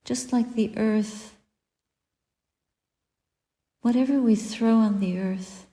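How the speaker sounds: ordinary talk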